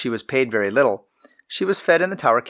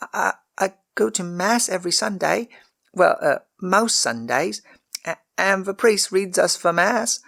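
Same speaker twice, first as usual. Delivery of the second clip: put-on high, halting girl's voice; stammering to cover herself; flustered young girl; 9 semitones higher than usual. A narrator's reading. Servant girl answers the lord about mass, high and flustered.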